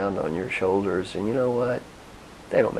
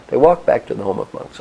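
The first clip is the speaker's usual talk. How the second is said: ordinary talk, nothing marked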